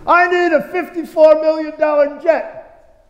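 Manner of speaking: high, slow, put-on voice of a greedy televangelist; mock greedy preacher. Pastor mocking a televangelist's demand, high, slow mock voice. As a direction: lampoon the jet-begging preacher. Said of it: "I can try that on the congregation".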